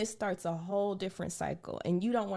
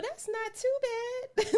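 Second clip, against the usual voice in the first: mock relieved inner voice; slower than usual. Imagined relief at small goal.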